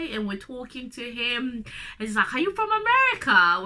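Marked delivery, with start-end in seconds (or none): 2.3-3.6 surprised officer. Retold or imitated. Immigration officer asking.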